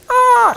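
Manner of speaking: put-on a failed try at a high toddler shriek; little girl screech. Uncle imitating his daughter's screech, a high strained squeal. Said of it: "and I heard this loud screech".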